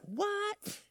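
high, startled mock "What?"; squeaky incredulous yelp; baffled crowd. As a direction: play the shocked listeners. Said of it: "And they're like".

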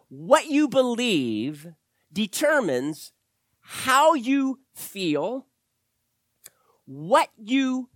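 slow, deliberate and spaced out; emphatic slow. Real, not acted. Pastor slowing down to drive home belief, slow and deliberate. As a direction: land each phrase slowly for weight. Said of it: "Because listen to me".

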